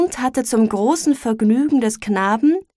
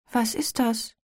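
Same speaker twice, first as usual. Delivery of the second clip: put-on softer, hesitant child's question; timid, subdued; 8 dB quieter than usual. A narrator's reading. Boy repeats his question to his grieving mother, timidly. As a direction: ask it shyly, half afraid. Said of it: "zaghaft und in kleinlautem Tone".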